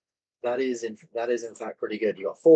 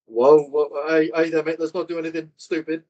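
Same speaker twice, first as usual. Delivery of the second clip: higher, louder alarmed plea; surrendering minions; 5 semitones higher, 6 dB louder than usual. Acted. Cornered minions beg the heroes to stop, raised voice. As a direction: hands up, talk the attackers down. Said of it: "and go".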